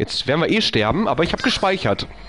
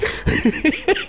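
panicked laugh. Real, not acted. Panicked moment.

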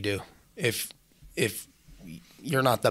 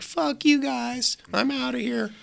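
much higher, slow mock voice; mocking a quitter; slower than usual. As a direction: lampoon the guy who bails in a squeaky voice.

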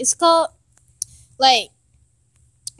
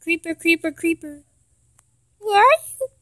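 mock whimpering song; 3 semitones higher than usual. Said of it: "I'm literally gonna cry".

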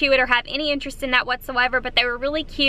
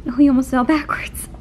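whisper. Real, not acted. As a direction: breathe it without voice, worried for the pet. Pet nearly topples over.

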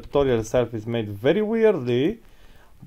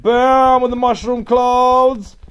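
hype shout; 10 semitones higher, 7 dB louder, slower than usual. Pretend. Announcer-style hype shout.

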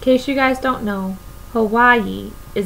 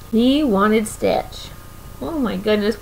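usual speaking voice

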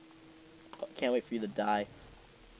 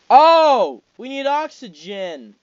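alarmed cry; 9 semitones higher, 12 dB louder, much slower than usual. Real, not acted. Alarm at oxygen.

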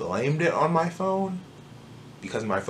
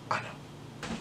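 whisper; 16 dB quieter than usual. Real, not acted. Quiet aside to viewers.

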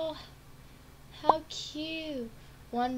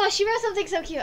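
delighted gush; 8 semitones higher, 8 dB louder, much faster than usual. Real, not acted. Delighted by a message.

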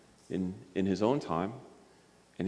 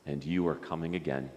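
lower, soft prayer lines for others to repeat; 4 semitones lower, 7 dB quieter, slower than usual. Acted. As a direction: lead a gentle prayer phrase by phrase. Leads prayer for repetition.

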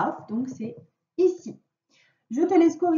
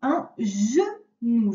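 slow dictation; much slower than usual. Shown as a demonstration. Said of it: "On y va".

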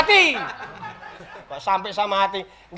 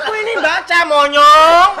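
much louder with a rising end; loud insult yell; 4 semitones higher, 9 dB louder than usual. Acted. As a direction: bark the insult. Yelling insult at friend.

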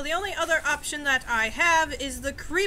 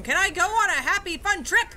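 mock excited; 5 semitones higher, 5 dB louder than usual. Playful high mock cheer.

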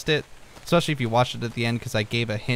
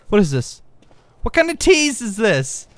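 mock indignation; 8 semitones higher, 5 dB louder than usual. Real, not acted. Blocked by an invisible wall.

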